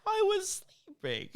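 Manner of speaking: very high, slow, squeaky outrage; offended cat voice. Retold or imitated. Friend voicing his cat woken up, high slow squeaky outrage. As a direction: play the rudely woken cat.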